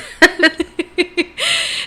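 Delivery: laughing. Real, not acted. Laughing at own story.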